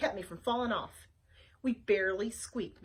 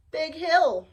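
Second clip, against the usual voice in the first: goat voice; 6 semitones higher, 9 dB louder than usual. Acted. Goat Nina calm plan.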